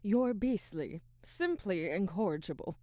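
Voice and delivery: hammed-up indignation at a teasing colleague; mock scolding. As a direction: scold them fondly, loud.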